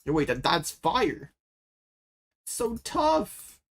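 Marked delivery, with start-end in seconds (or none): 2.4-3.6 high amazed exclaim. Real, not acted. Amazed at opponent's play.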